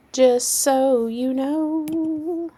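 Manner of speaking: high, slow sing-song drawl. Pretend. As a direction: stretch it out in a silly voice. Repeated in put-on voice.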